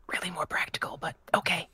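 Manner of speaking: hushed in-character reply; soft, breath-only voice; whisper. Acted. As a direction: whisper it, very softly. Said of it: "this whispering thing is very weird".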